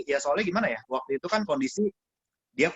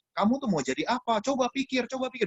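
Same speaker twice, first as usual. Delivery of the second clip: high-pitched nagging scold of a younger brother; retold rough lecture, pitched up; harsh scolding; 8 semitones higher than usual. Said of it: "rada kasar lagi dulu gua gitu kan".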